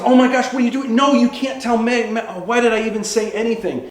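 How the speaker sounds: high, urgent protest; flustered alarm. Acted. Man stopping his friend telling Megan, flustered alarm. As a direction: panic that the secret will get out.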